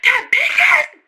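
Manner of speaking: very high, loud outburst; mock shrieking. Girl pointing them out to dad, mock shrieking. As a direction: imitate screaming out who did it. Acts out screaming answer to dad.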